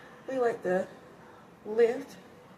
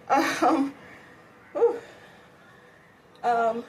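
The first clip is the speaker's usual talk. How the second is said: out of breath; 4 semitones higher, much slower than usual. Real, not acted. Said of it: "breathing really heavily right now".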